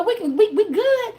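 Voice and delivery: normal talking voice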